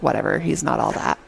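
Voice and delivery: low, unimpressed brush-off; dismissive, dropped-pitch retort; dismissive girl imitation. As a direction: imitate a girl who won't be impressed. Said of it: "Seal's like".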